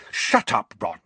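a sharp, cross Shut up; angry. A narrator's reading. Hermione shuts Ron up, angry. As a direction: cut him off in irritation. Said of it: "said Hermione angrily".

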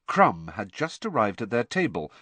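plain reading voice

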